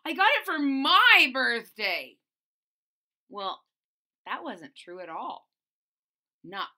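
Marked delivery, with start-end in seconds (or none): 0.0-2.2 put-on loud insistent girl; yelling lie. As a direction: shout the lie. Continues yelled lie.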